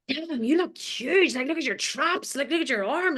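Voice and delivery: lightly voiced gushing colleagues; admiring office girls. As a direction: hype up a friend's muscles. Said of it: "all the girls in the office are like".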